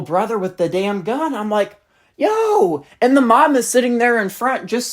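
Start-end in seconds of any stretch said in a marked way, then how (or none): 2.2-2.8 high, sudden yo; sharp call out. Retold or imitated. Narrator calling out at kid hitting his brother, sharp high yo. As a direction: snap out a warning yo.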